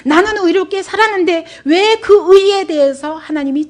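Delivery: high, loud retold grievance; indignant protest. Job charges God with being unfair, indignant. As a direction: cry out against unfair treatment as Job.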